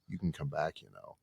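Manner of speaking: gentle reassurance. Retold or imitated. The Lord calling him back after he stormed out, soft and tender. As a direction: invite him back gently like a patient father. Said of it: "the Lord was like".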